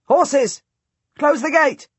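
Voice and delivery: high, loud alarm; guard shouting. A narrator's reading. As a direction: yell the warning to the gate. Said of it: "He shouts".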